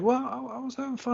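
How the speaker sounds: put-on high, soft little-kid voice; imitated child. Boy excusing himself to his uncle, high soft kid voice. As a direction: mimic a scolded kid making excuses.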